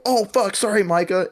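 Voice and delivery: high startled "oh fuck, sorry"; retold startled apology. Shooter apologizing to teammate Micah, startled. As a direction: blurt out a sudden sorry. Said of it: "And I go".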